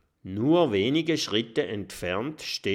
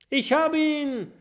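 viel höher, jubelnd gerufen; triumphierender Ruf; 4 dB louder than usual. Acted. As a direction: freut sich über den Treffer. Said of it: "ruft Oskar". (Oskar jubelt nach dem Wurf, hoch gerufen)

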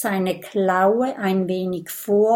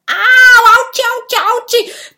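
very high, loud yelping; outburst of pain; 12 dB louder, faster than usual. Acted. Dragon interrupting the story, yelping in pain. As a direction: burst out in exaggerated ouches. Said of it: "bricht es aus Big Bo hervor".

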